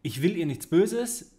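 normal talking voice

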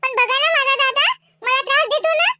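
put-on high, childlike voice; little niece. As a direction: complain and question like a small girl. Little niece chats with uncle.

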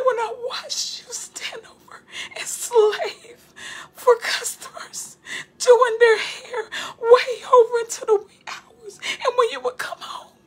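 high and crying through the words; tearful. Real, not acted. Preacher voicing God's jealous rebuke while weeping. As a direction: let the tears break the voice as you speak God's words.

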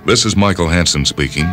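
ordinary talk

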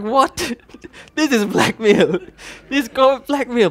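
high, loud laughing outrage; laughing disbelief. Retold or imitated. Preacher recalls God's threat, laughing in loud disbelief.

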